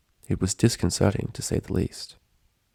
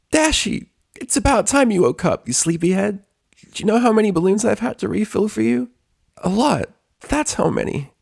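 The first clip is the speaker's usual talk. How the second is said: very high, louder bubbly voice masking distress; forced cheer; 14 semitones higher, 3 dB louder than usual. A narrator's reading. Pinkie greeting the waking Dash, high forced cheer hiding tears. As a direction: cheerfulness stretched paper thin. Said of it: "Her voice was distraught".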